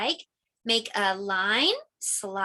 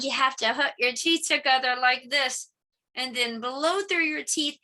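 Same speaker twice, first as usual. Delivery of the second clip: ordinary talk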